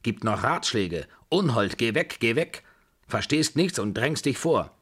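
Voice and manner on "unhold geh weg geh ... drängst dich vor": raised, indignant voice; angry scolding. A narrator's reading. Grandmother chasing off meddling Desgrieux, angry scolding. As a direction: shoo away a meddler.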